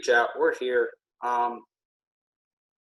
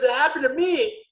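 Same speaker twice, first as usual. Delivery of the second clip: high-pitched mock distress; hammed-up high wail; imitated crying; 12 semitones higher, 3 dB louder than usual. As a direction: mimic an upset, tearful complaint without real sobs. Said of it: "she starts crying".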